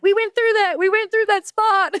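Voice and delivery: high delighted recognition at the match; excited recognition. Retold or imitated. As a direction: squeal it like spotting your own rehearsed move. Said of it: "and I'm like".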